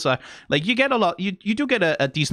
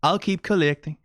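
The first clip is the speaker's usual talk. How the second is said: put-on Sjælland accent; 8 semitones higher than usual. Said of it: "it sounds like a Sjælland accent".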